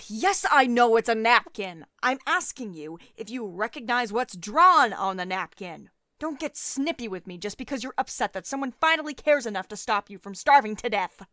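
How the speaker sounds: loud, irritated scolding, half shouted; exasperated snapping. Acted. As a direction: snap back at someone being snippy. Don't get snippy with me.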